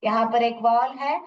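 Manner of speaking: ordinary talk, nothing marked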